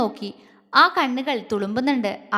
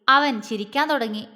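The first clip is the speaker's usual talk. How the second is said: ordinary delivery, nothing marked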